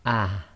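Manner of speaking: ah with a soft breathy h from the low throat; ah ending in a gentle throat h. Shown as a demonstration. Teacher contrasts soft ha with the hamza. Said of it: "the ha version is".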